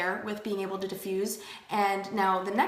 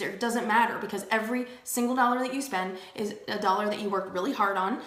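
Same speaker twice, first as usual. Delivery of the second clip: ordinary delivery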